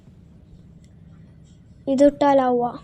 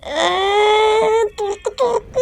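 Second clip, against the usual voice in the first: high, urgent repeated pleas; frantic pleading; 6 semitones higher than usual. Real, not acted. Gamer trapped in the falling lift pleads with the door, frantic. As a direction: beg the door to open, frantic.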